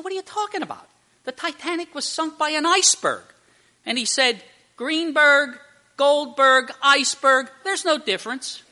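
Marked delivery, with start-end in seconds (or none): 0.0-3.2 high-pitched mock bafflement; acted incredulous protest. Joke character baffled by absurd accusation. 4.8-8.6 loud, higher-pitched punchline delivery; acted dismissive insistence. Joke character insists on punchline.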